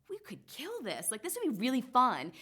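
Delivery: high, bright burst of excitement about the idea; excited. Retold or imitated. Pitching the business idea to her husband, excited. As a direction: pitch it up like the idea just clicked.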